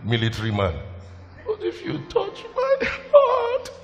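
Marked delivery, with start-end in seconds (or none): 1.5-3.7 high, slow, tearful voice breaking into a laugh; crying laugh. Real, not acted. Pastor telling the church he is emotional, crying and laughing. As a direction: let it crack into tears, then laugh it off. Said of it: "I'm a very emotional person".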